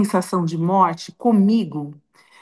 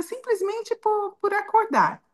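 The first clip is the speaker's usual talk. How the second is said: high-pitched, wondering joy at being alive; delighted wonder; 10 semitones higher, 4 dB quieter than usual. Retold or imitated. Grandmother retelling her prayer, high delighted wonder. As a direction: lift the voice up in grateful amazement.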